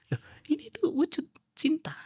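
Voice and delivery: gently whispered, high and tender; lembut. Retold or imitated. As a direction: berbisik lembut menjelaskan cinta. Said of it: "Kata Rasulullah".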